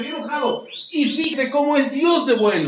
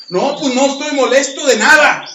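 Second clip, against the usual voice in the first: testy denial; 3 semitones higher, 6 dB louder than usual. Retold or imitated. Cain denying his anger to God.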